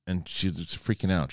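usual speaking voice

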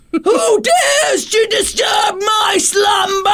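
booming, slow, high cave guardian; Cave of Wonders. Retold or imitated. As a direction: roar it like an ancient magic cave. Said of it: "I'm like the fucking Cave of Wonders".

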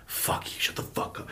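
whispered, cold dismissal; whispered retort. Retold or imitated. Sister dismissing a hurt sibling, whispered retort. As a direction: whisper it as a quiet put-down. Sister's hurtful words acted out.

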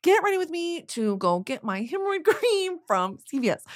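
high, singsong vlogger parody; mock influencer voice. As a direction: chirp it like an affected influencer intro. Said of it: "That's the bit".

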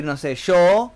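normal talking voice

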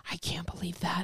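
imitating an awestruck near-whisper; hushed, voiceless quote of someone amazed; awed whisper. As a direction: whisper it in disbelief. Retelling an awed reaction.

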